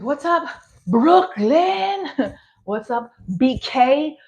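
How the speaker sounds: high, slow, hammed-up hype greeting. Comedian mocking her own stage opener, slow high hype. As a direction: drag out Brooklyn like an eager host.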